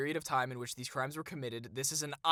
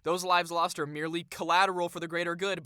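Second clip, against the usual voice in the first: normal talking voice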